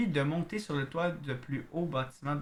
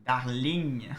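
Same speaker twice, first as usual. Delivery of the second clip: Darling said the French way, louder; French-accented darling; 5 dB louder than usual. Shown as a demonstration. Reading a love letter aloud, French-accented Darling. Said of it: "quand les gens disent le mot darling".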